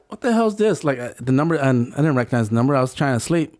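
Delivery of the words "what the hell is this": higher-pitched irritated question; mock exasperation at a ringing phone; baffled and bothered, acting out the moment; retold annoyed disbelief. Irritated at repeated calls, retold.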